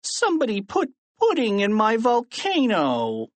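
put-on high, whiny kid's complaint; childish whine, sliding down at the end; whining. A narrator's reading. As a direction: wail it like a wronged child. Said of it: "whined Freddie".

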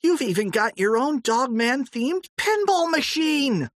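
high, shouted delight; excited shout. A narrator's reading. Harold shouting to George about the pinball machine, thrilled. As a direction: yell it like a thrilled kid. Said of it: "cried Harold".

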